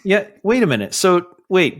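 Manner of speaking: normal talking voice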